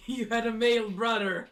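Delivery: high, loud teasing voice; sarcastic fake praise; mocking. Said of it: "I was just about to say".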